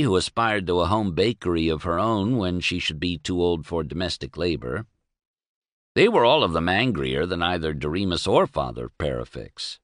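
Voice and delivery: ordinary delivery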